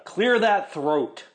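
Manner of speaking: bossy command voice. Retold or imitated. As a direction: bark it slow like a stern lady. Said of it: "She goes".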